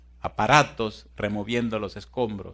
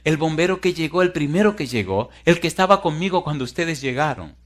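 higher-pitched pressing explanation; acted insistence; 6 semitones higher, 6 dB louder than usual. Rescued woman explains to fire captain, insistent. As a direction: pile up the details insistently.

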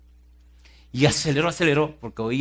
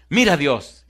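sudden raised plea, falling on Dios; crying out to God; 5 semitones higher, 9 dB louder, slower than usual. Retold or imitated. Frightened woman on the stairs begs God, crying out. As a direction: call out urgently to heaven. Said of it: "empezó a rogar y a clamar a Dios".

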